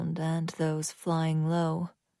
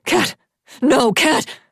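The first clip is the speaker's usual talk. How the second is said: loud, high frantic cry; anguished husband calling out; desperate cry; 8 semitones higher, 9 dB louder, slower than usual. A narrator's reading. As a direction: cry out her name in despair. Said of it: "overpowered by Axel's cries".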